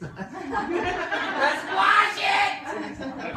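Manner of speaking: high, slow, laughing cry; laughing exclaim. Real, not acted. Cook laughing at her unwashed produce, drawn-out cry.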